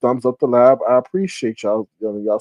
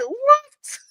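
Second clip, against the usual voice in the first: much higher squeal of disbelief; shocked what. Retold or imitated. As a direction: squeal it, half laughing. Retelling the homeless special offer.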